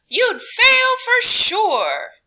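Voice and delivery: slow, high, loud warning; loud slow high police voice; police scolding. A narrator's reading. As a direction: drag it out up high like a disbelieving cop. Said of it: "they would say".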